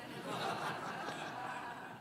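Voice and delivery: airy unvoiced laugh at her own joke; real laugh, air only; breathless laugh. Real, not acted.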